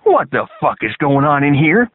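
loud gruff drill sergeant roar. A narrator's reading. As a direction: roar it at the barracks. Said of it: "the drill sergeant roared".

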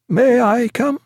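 high, pleading question; sick patient begging the doctor; anxious cry. A narrator's reading. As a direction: beg to come along. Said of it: "cried Martin".

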